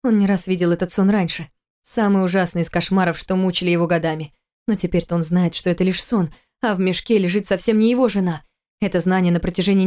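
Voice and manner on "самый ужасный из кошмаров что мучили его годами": ordinary delivery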